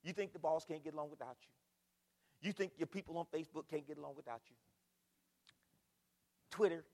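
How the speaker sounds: usual speaking voice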